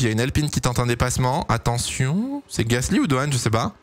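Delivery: normal talking voice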